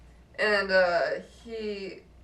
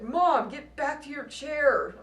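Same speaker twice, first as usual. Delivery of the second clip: slow, higher mock scolding; drawn-out, pitched-up imitation of nagging; retold yelling; 6 semitones higher, 3 dB louder, slower than usual. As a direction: imitate a son ordering his mom around. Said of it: "started yelling at her".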